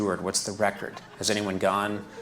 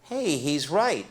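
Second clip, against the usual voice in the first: mock surprise; 6 semitones higher, slower than usual. Toilet nudges him, mock surprise.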